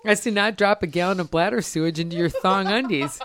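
ad read. Acted. Reads a spoof sponsor ad.

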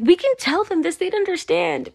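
thrilled retold revelation; excited realization. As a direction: blurt it out like a eureka moment.

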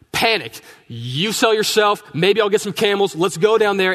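mildly acted panic, high and loud; worked-up, urgent push to go through with it; panicked scheming. Abraham talking his wife into the plan, panicky.

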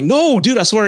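high, loud, scared denial; frantic plea. Retold or imitated. Narrator pleads with the furious husband, high and frantic. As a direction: swear it's true, voice jumping up in panic.

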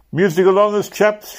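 a high, hearty shout to start the song; rally the men with a call. A narrator's reading. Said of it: "shouted Crass".

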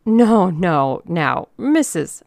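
loud, stalling protest; flustered placating. A narrator's reading. Organizer fumbles for the angry lady's name, loudly stalling. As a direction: fend her off, slowing on the title.

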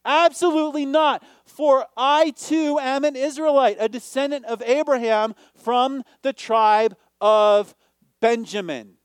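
loud, high and forceful; emphatic Paul. Acted. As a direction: declare it like Paul defending himself. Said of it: "It's sort of emphatic".

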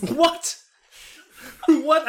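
high, loud laughing what; reacting to a friend's story, laughing out loud; laughing disbelief. Real, not acted. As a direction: laugh through the words.

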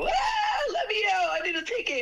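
high, loud whiny begging to be let out; hammed-up panic on a ride; mock panicked pleading. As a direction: plead frantically like a scared rider. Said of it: "just go".

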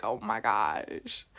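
very low, slow mock swoon; low drawn-out awe, played up; retold swoon. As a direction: drop right down and draw it out. Acts out swooning at his green eyes.

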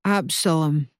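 solemn, reverent name; like an amen. Acted. Cornet offering his given name to Ursa, solemnly. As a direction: give the name like a hallelujah. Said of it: "he says it like a hallelujah or an amen".

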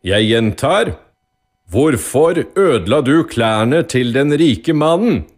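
high, loud, slowed demand; loud, irritated. A narrator's reading. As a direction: judge losing patience, repeating forcefully. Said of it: "sa dommeren høyt og irritert".

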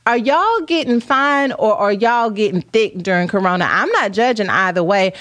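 usual speaking voice

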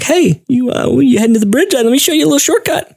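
high, fast eager come-on; eager teen boy. Retold or imitated. Teen boy eagerly offering a shortcut, high and fast. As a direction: rush the offer like a keen kid. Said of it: "he's like".

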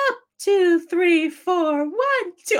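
high, loud counting like a drill coach; gym teacher counting. Retold or imitated. Mocking the gym teacher's barking count, high and loud. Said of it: "she's like".

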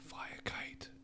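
whispered dismissal. Real, not acted. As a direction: breathe it out under your breath. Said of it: "that's not even passive aggressive".

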